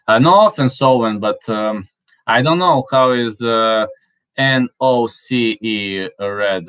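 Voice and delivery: usual speaking voice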